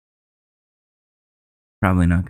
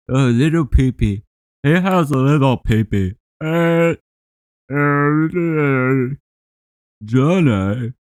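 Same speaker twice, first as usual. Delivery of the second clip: dumb simple voice; 5 semitones higher, slower than usual. Pretend. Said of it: "I resort to, like, simple".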